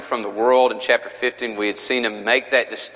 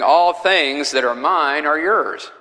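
slow, raised "All things that are mine are yours"; emphatic quotation; 5 semitones higher, 3 dB louder, slower than usual. Real, not acted. Teacher quoting Jesus' interesting statement in verse ten, emphatic quotation. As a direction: lean on "All" and draw the phrase out.

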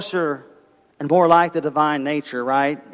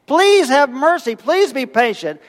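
pleading servant; 8 semitones higher than usual. Acted. Servant begs for mercy.

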